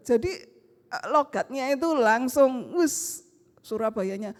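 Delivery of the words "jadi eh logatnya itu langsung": ordinary delivery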